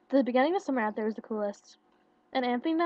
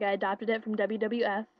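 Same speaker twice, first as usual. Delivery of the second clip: ordinary delivery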